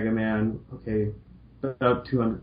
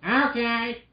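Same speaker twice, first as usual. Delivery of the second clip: a light Roll voice; 12 semitones higher than usual. Acted. Roll's voice.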